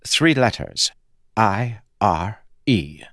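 a hint of an otherworldly voice; slow spelled-out letters; lightly voiced spirit. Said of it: "pronouncing it in a slightly different way".

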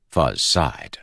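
ordinary delivery, nothing marked

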